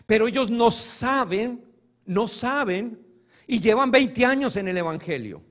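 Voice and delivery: ordinary delivery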